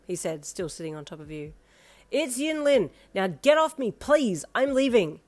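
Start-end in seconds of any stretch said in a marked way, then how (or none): 2.1-5.2 firmly. A narrator's reading. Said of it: "you said firmly".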